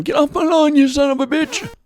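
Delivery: put-on high, strained old geezer yelling "Get off my lawn"; cranky old man. Old men with canes yelling at trespassers, in a high cranky old-man screech. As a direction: shriek it like an ancient codger waving his cane. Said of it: "a bunch of old men with canes. Going".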